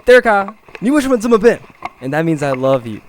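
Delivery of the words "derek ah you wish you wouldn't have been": high, loud scolding mom. Retold or imitated. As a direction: scold him like his mother. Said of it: "She would say".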